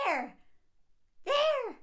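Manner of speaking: put-on high, loud child's cry; screaming kid. Child pointing and shrieking at her dad, high and loud. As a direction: shriek it like a panicked toddler. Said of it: "The kid's like".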